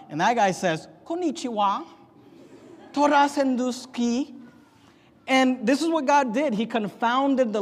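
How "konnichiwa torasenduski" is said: higher fake Japanese greeting; mock Japanese. Builder greeting a confused coworker in fake Japanese, mock. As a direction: toss off a mock Japanese hello.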